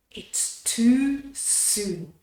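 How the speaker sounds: ordinary delivery, nothing marked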